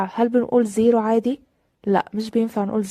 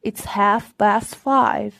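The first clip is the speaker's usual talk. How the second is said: very slow, clear teacher demo; deliberate classroom pacing; slow model reading; much slower than usual. Shown as a demonstration. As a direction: stretch each word for the class. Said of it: "very slow repeat".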